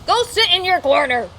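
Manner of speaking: loud, high playful scold; mock scolding. Streamer teasing a viewer she'd time out, mock scolding. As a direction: send them to the corner, teasing.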